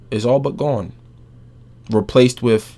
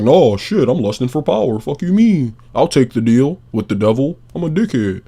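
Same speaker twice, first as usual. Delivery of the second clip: higher, louder mock brute bragging; louder, higher jeering Kratos voice; mock Kratos boast; 4 semitones higher, 5 dB louder than usual. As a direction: ham up a proud god-killer shouting his own greed. Voicing Kratos choosing power.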